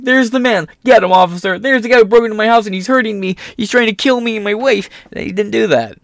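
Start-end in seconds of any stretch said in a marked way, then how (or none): 0.0-4.9 mock urgent plea. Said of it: "been like".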